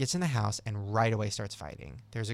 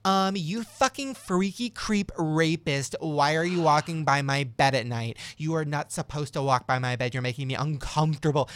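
retold angry accusation; 3 semitones higher, 5 dB louder than usual. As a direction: act out an indignant telling-off.

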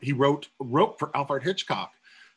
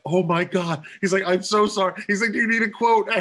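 high, loud, flustered apologising; flustered apology; 7 semitones higher, 6 dB louder, faster than usual. Retold or imitated. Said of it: "Stephen Sondheim's like".

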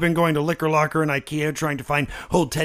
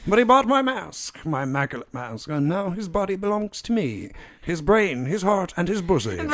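put-on a terrible British accent, steadier now; hammed-up English grande dame; Maggie Smith voice. Possessed Willie gloats to Dorothy in a bad English accent. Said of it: "it's a terrible accent".

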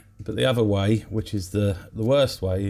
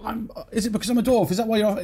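much higher, offended yelp of a question; indignant dwarf again; 12 semitones higher, faster than usual. Retold or imitated. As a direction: snap back high and huffy. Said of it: "And the dwarf goes".

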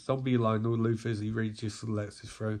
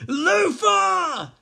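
loud, high drawn-out yell; angry mob shout; 9 dB louder than usual. Acted. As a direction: yell the name as a vengeful crowd. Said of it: "say a bunch of villains".

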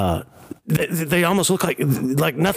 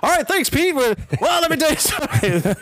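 high mock voice; 13 semitones higher than usual. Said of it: "And like".

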